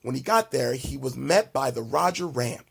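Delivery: usual speaking voice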